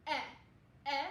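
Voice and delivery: "Eh" as the short vowel that starts elephant; short E sound. Shown as a demonstration. Teacher answering what E's sound is, short E sound. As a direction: give "Eh" as an isolated short E. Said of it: "What is E's short sound?".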